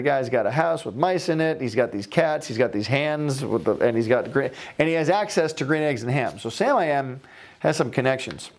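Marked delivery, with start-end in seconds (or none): none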